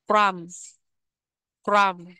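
"Crumb" ending on M, B left silent. Shown as a demonstration. Teacher showing learners the unread letter, silent B in "Crumb". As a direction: say "Crumb" as "crum", with no B sound. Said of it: "chữ bờ ở trong cái từ crumb này được ghi nhưng không có đọc".

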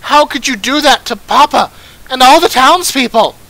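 loud, high, anguished confrontation; outraged accusation. Acted. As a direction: hurl the accusation at the killer. Daughter confronts her father's killer.

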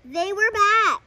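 loud, high shocked exclamation; alarmed cry. Acted. Reader gasps that the underwear returned, in loud alarm. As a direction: blurt it out in alarm.

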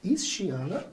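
soft, confidential query in doubt; mildly doubtful question. Acted. Teacher acting out approaching someone to check a name, quietly doubtful. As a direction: ask quietly, as if unsure. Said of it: "eu tô com dúvida".

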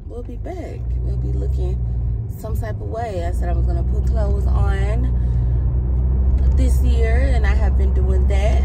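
ordinary talk

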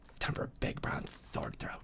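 fast hushed whisper. Acted. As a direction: whisper it like a secret. Reading title dramatically.

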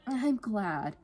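high, tender sisterly praise; affectionate. A narrator's reading. Smiling sister complimenting Freya, affectionate.

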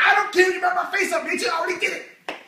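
high, loud imitation of a reckless brawler; hammed-up angry screech; mock belligerent shouting. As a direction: yell it like someone with nothing to lose. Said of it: "They'll be like".

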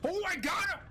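high, loud excited yelp; excited squeal. Real, not acted. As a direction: squeal with sudden triumph. Excited kill in game.

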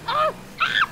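two sharp yelps; short alarmed screams. Real, not acted. Player losing control of the play, short screams. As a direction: scream twice in quick bursts of alarm.

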